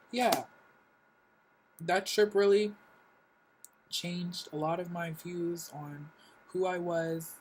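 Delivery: normal talking voice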